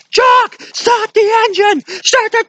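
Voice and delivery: very high, loud desperate shout, often slow; running from the tribe, Indy shouts at the pilot Jock in a hammed-up high panic; panicked yelling. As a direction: scream for the pilot to take off. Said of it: "going like".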